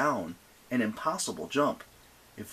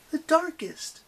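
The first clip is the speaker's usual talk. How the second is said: high, goading; girl voice; 4 dB louder, slower than usual. Acted. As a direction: push them, high. Annabeth voiced.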